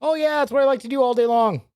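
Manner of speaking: high-pitched mock enthusiasm; sarcastic. Real, not acted. Mock complaint to co-host over editing.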